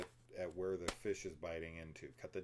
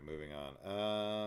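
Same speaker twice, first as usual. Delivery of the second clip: drawn-out moving on; 4 semitones higher, 7 dB louder, much slower than usual. Real, not acted. Drawn-out topic change.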